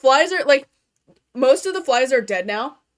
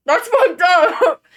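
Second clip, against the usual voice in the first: higher, louder, slow shocked exclamation; shocked outburst; 8 semitones higher, 11 dB louder, much slower than usual. Real, not acted. Reacting to a friend's creepy cartoon, shocked outburst. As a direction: blurt it out stunned and stretched.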